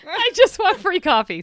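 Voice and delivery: mock mom voice. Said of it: "voicing mom's email".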